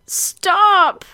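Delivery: high, loud outburst; blurted cry. Acted. Heroine trying to ask him to stop, blurted loudly. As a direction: blurt each word out in alarm, high. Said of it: "incoherently blurt out".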